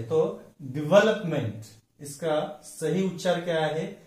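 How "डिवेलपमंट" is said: Development as "di-VEL-up-munt", stress on VEL; correct stress. Shown as a demonstration. Teacher giving the right stress, correct pronunciation. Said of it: "third syllable from the last".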